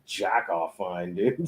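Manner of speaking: usual speaking voice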